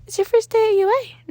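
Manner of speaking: high, bright little principal voice; a cheerful small creature greeting a student; high Nezu voice. Acted. Principal Nezu welcomes Danny into his office, in a high cheerful voice.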